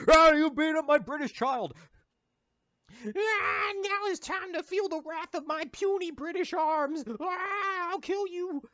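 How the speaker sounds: high, loud falsetto rage; mock furious British parent. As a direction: squeal out the threat.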